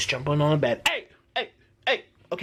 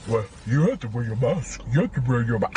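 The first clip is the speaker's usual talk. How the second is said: mock stern official; 6 semitones lower than usual. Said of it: "he was like".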